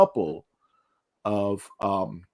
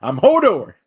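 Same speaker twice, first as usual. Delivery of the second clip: loud, higher mock Hodor; 7 semitones higher, 9 dB louder than usual. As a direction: boom out the giant's one line. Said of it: "Groot joke follow-up".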